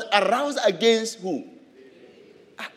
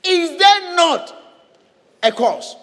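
higher, louder and slow, a ringing challenge; bold challenge; 10 semitones higher, 6 dB louder, slower than usual. Acted. David answers his accusers, bold ringing challenge. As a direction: throw down the challenge. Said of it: "Then he said".

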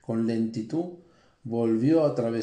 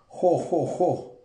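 high, hearty ho ho ho; booming laugh; 5 semitones higher, much slower than usual. A narrator's reading. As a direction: laugh it out like a dragon. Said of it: "rió el dragón".

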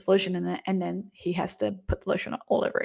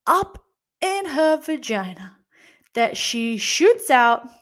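ordinary delivery

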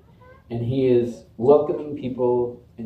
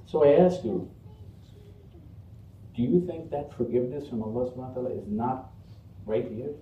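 normal talking voice

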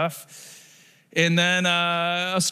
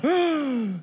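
high, audible gasp of amazement; big gasp; 8 semitones higher than usual. Shown as a demonstration. Preacher showing the missing collective gasp, mock-amazed gasp. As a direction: gasp as if hearing it for the first time. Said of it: "collective gasp anymore".

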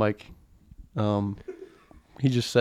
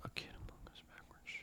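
whispered under the breath; whispering; 31 dB quieter, faster than usual. Real, not acted. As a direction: whisper it to yourself. Repeating a dirty joke quietly.

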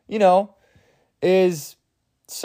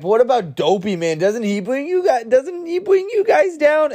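druggie dwarf; 8 semitones higher than usual. Retold or imitated. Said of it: "Druggy's like".

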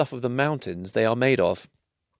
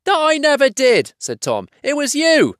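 high indignant denial; 4 dB louder than usual. Acted. Tom denies, blaming Bert.

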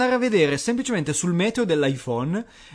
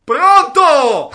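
molto più alto e forte; urlo al telefono; 9 semitones higher, 11 dB louder than usual. Retold or imitated. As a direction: grida al telefono come una signora anziana. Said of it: "apre la chiamata". (signora anziana che urla al telefono)

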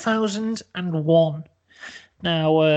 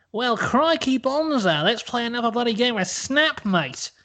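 put-on Australian accent; 6 semitones higher than usual. Scott Morrison challenging Connery, high broad Australian accent. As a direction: ham up a broad Australian bloke. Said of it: "Scott Morrison says".

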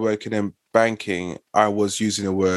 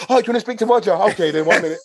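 posh phone voice; 10 semitones higher, much faster than usual. Retold or imitated. Brother answers phone posh.

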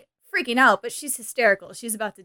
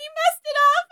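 much higher, whiny fake-distress voice; mock hysterical Cher; 14 semitones higher than usual. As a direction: play the helpless damsel, over the top. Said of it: "She's putting on a hysterical".

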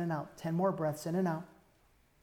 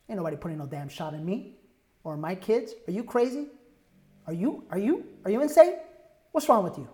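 defiant; 2 semitones higher, 8 dB louder than usual. Acted. Defiant rant against shot.

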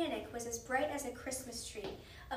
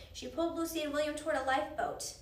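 ordinary delivery, nothing marked

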